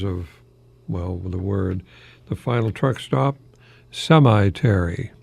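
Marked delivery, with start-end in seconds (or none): none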